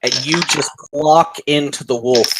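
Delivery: ordinary delivery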